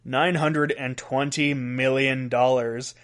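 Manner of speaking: slow, deliberate delivery of the figure; said slowly. Shown as a demonstration. Host making sure listeners grasp the stolen sum, said slowly. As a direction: spell out the huge sum, unhurried. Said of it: "let me say this slowly".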